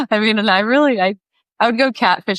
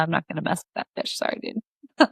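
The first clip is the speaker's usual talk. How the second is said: much softer, quick, ending whispered; mock muttered refusal; 8 dB quieter than usual. To the fisherman, a quick soft sorry-dude refusal. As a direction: back away apologetically under breath.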